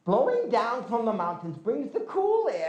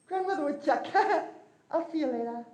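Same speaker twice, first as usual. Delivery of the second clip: higher, quick laughing exit; cheeky escape; 9 semitones higher, much faster than usual. Acted. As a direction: laugh and dash off as the boy. Glooskap slips away laughing.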